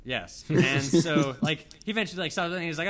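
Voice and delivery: usual speaking voice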